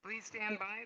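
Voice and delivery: ordinary talk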